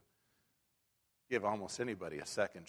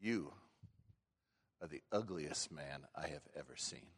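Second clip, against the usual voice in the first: low, much softer deadpan parrot jab; the parrot quietly delivers the insult; 5 semitones lower, 10 dB quieter than usual. Acted. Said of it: "And the parrot goes".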